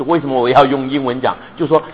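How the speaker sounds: ordinary delivery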